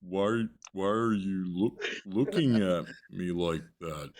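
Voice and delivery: a hint of a slow, dazed, worried coworker; correcting the impression. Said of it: "She sounded like".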